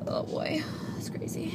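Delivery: breathy whispered aside. Real, not acted. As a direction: whisper it to yourself. Whispered aside after musing.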